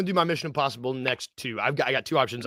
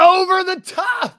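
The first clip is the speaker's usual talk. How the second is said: very high, loud, slow shout of joy; real excited shout; thrilled shout; 8 dB louder, slower than usual. Real, not acted. Friend recognises the beloved movie.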